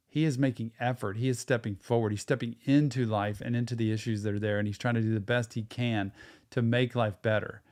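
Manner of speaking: ordinary talk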